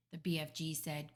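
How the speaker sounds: usual speaking voice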